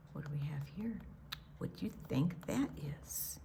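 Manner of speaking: soft curious whisper. Real, not acted. Grandma asks the child about the picture, whispered. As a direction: ask it in a hush.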